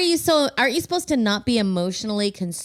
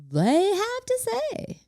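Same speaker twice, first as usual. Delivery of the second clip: slow, much higher sing-song; 3 dB quieter, slower than usual. Acted. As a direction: stretch it up high and slow. Host teasing caller intro.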